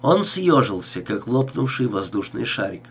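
plain reading voice

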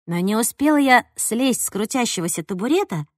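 ordinary delivery

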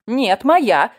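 loud, high possessive protest; indignant lady's outcry; acted shout. A narrator's reading. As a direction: cry out to claim what's yours. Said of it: "закричала дама".

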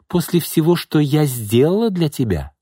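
high, indignant, stressing сделала; hurt fury at ingratitude; angry reproach. A narrator's reading. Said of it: "разозлилась Изольда". (обиженно-гневно)

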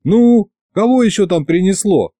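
called out sharply at the door; irritated shout toward the door. A narrator's reading. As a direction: snap back at the knock. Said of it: "крикнул я".

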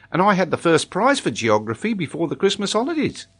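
put-on high-pitched child's voice, proudly telling; proud schoolboy. A narrator's reading. Boy tells the geographer of his prize, high proud voice. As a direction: play a keen young pupil.

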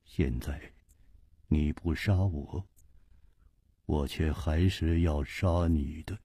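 低沉轻声，一字一句; 压低嗓音，停顿着宣告杀意; 平静威胁的无花. Acted. Wuhua calmly threatens Chu. (不动声色地低声威胁)

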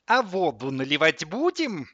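high, loud snide question. A narrator's reading. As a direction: needle him with mock inquiry. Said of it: "ехидно осведомился тот". (ехидно, с издёвкой, повыше)

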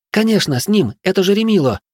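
high, rushed gabbling; rapid chatter. Acted. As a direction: rattle it off fast. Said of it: "тараторит Зора так быстро".

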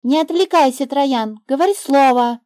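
put-on the girl hurrying the snake in the word game; little girl. A narrator's reading. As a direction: urge him on like an impatient child.

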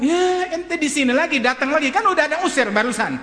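very high-pitched acted exasperation; exasperated rebuke. Father scolds the returning teacher, exasperated. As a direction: scold him for turning up.

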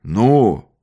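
affectionate loud growl; friendly monster voice. A narrator's reading. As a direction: growl it gently, like a kind beast. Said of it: "ласково прорычал низкий голос".

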